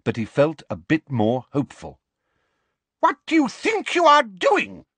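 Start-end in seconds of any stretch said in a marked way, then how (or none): 3.0-4.9 shrill, much higher and louder furious demand; an outraged old librarian pouncing; angry librarian. Acted. Madam Pince catches them eating chocolate, furious and much higher.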